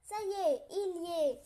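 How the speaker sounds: exclamation joyeuse et forte; triumphant. A narrator's reading. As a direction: announce it in triumph. Bear finally gets in.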